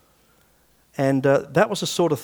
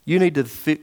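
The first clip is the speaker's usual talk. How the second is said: ordinary talk, nothing marked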